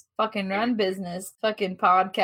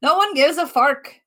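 higher, louder mock Aussie with R-coloured "fark"; attempted Aussie accent; 5 semitones higher, 6 dB louder than usual. Shown as a demonstration. Showing everything's R's in Aussie, loud attempted Aussie accent. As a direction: punch out a bad Aussie accent that drifts Irish. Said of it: "everything's ours".

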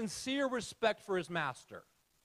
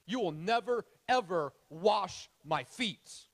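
slow, stressed never ever; emphatic refusal; slower than usual. Acted. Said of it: "Peter protested".

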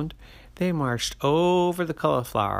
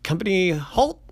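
sergeant's command; 9 semitones higher, 6 dB louder than usual. Acted. Sergeant orders halt.

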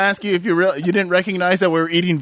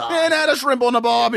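higher-pitched mock Aussie; 6 semitones higher than usual. Joining the friend's Australian riff, hammed-up Australian accent. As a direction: chime in as a cartoon Australian. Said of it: "we did a lot of stereotyping right there".

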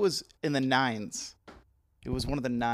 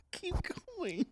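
laughing; 9 dB quieter than usual. Real, not acted. Still cracking up.

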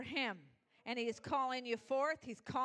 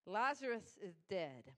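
ordinary talk, nothing marked

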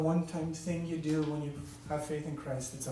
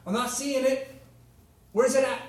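higher and louder, a mock demanding tone toward God; proud demanding attitude; 8 semitones higher, 5 dB louder than usual. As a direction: impatiently demand the promised salvation, hammed up. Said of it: "We don't come with a feeling of".

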